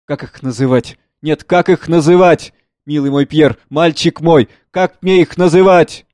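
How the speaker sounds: a father at a loss, pleading; anguished father. Acted. Father to his son, searching desperately for words.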